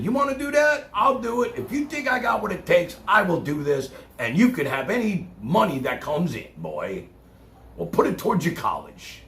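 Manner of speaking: booming high reply to the son; excited promise to the son; loud retold reply. Retelling his own reply to son.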